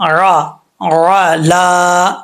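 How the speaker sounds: را را لا drawn out slowly; slow letter drill. Shown as a demonstration. Teacher drills letters for students slowly. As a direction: sound out را را لا letter by letter. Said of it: "حمزہ کا sound نہ آئے".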